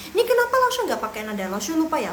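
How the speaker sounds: quick, high curious student voice; lightly voiced student query; mock student question. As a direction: play a puzzled pupil asking. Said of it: "imagined student question".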